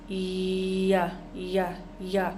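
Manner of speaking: ya said with the tongue tip down, no J sound; a soft Y glide on ya, not ja; the Y of ya kept light and open. Shown as a demonstration. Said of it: "the key is".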